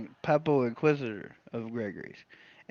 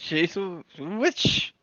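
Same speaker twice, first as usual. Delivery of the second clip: slow drawn-out mock accusation; 3 semitones higher, much slower than usual. Mock witch trial drawn out.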